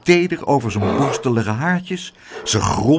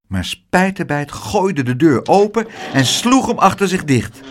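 ordinary delivery

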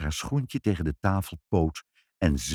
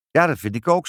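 put-on high, louder little girl; cheeky child; 7 semitones higher, 5 dB louder, faster than usual. A narrator's reading. Child agreeing with the dieting visitor, cheeky. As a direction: chime in like a tactless child.

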